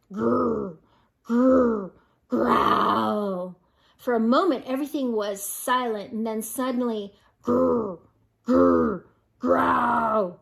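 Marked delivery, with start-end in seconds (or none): 0.1-3.6 low, louder rumbling growl; scary growl. A narrator's reading. Monster growl in the dark woods, rumbling and louder. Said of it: "the scariest sound of all". 7.4-10.4 scary growl. A narrator's reading. Monster growl in the dark woods, rumbling and louder. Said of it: "the scariest sound of all".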